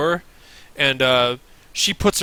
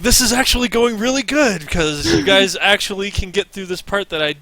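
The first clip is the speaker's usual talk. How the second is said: excited inner voice; 2 semitones higher, 5 dB louder than usual. Retold or imitated. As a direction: gush it out, delighted. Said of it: "I was like".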